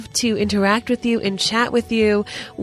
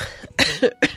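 dry cough from a cold. Real, not acted. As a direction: cough mid-sentence. Sick host coughing.

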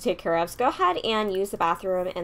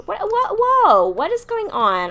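surprised; 12 semitones higher, 8 dB louder, slower than usual. Real, not acted. Sees sims eating her plants.

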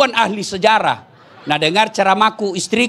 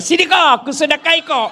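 higher, playful come-here; husband answering; 7 semitones higher than usual. Retold or imitated. Husband calling his wife over for her kiss, playful high call. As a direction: beckon her over teasingly.

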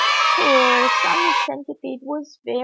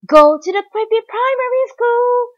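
very high, loud announcement; ringing sports-field shout; referee's yell; 12 semitones higher, 11 dB louder than usual. A narrator's reading. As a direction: holler it across the pitch as referee. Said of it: "yelled the referee".

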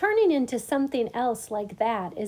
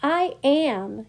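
raised, pleased declaration; self-admiring; 4 semitones higher, 4 dB louder than usual. A narrator's reading. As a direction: admire yourself out loud. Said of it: "He was admiring his reflection".

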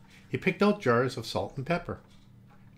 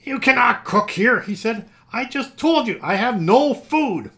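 angry protest; 10 semitones higher, 10 dB louder, slower than usual. Acted. Scrooge jumping angrily.

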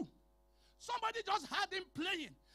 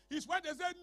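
ordinary talk, nothing marked

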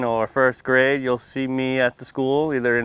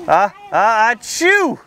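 big sneeze; 7 semitones higher, 4 dB louder, much slower than usual. Acted. Acting out the dragon's sneeze.

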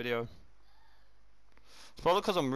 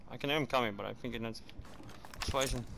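whispering while hiding; 2 semitones lower, 8 dB quieter than usual. Real, not acted. Hiding, enemy coming.

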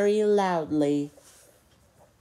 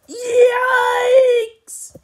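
very high, loud drawn-out yikes; long high-pitched scream of alarm; startled shriek; 13 dB louder than usual. A narrator's reading. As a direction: shriek it out in mock fright. Story character startled by shark.

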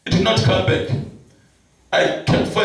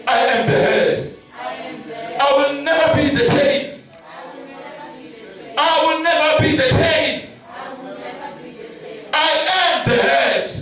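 call-and-response prayer; 4 semitones higher than usual. Real, not acted. Leads congregation in repeated prayer.

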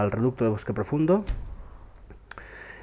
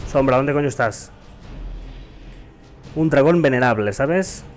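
raised urgent calls; calling out urgently; 3 semitones higher than usual. Real, not acted. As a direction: shout for the horse. Calls horse during dragon fight.

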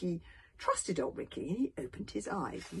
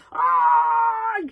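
long, high, loud scream; terrified yell; 13 semitones higher, 14 dB louder than usual. A narrator's reading. The rest of the tribe fleeing the supernatural, yelling. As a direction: yell out in panic. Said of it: "yelled the rest of the tribe".

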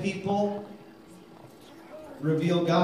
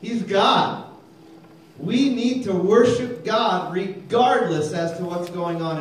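loud, raised preacher voice, slowing; preaching at full force; 4 semitones higher, 5 dB louder, slower than usual. Real, not acted. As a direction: proclaim it to the congregation. Preaching climax.

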